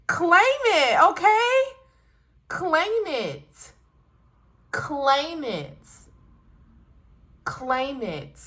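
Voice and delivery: rally them, then let it settle; a rousing call, dying down; fired-up urging. Real, not acted. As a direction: claim it shouted high, then fading. Urging viewers to claim it.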